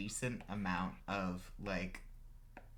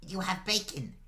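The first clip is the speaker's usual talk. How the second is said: put-on high, loud small-child voice; eager little boy; 10 semitones higher, 5 dB louder than usual. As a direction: marvel like a five-year-old reaching for a toy. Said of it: "reaches his arm up towards it and goes".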